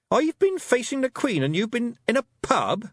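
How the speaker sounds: outraged at them; Tiffany indignant. A narrator's reading. Tiffany scolding the Feegles, indignant girl voice.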